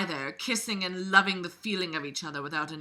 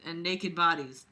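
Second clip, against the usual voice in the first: usual speaking voice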